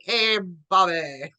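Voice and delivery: slow, stretched Southern twang greeting; mock Southern accent. Podcaster mocking a wrestler's fake accent, slow Southern twang. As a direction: imitate a put-on Southern drawl. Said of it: "not a, like".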